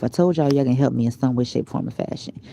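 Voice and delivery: ordinary delivery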